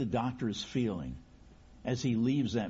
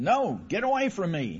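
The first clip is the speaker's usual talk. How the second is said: loud, higher, pushing the doctor away; angry refusal; 6 semitones higher, 5 dB louder than usual. Acted. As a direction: snap at him to back off. Injured man refusing the doctor.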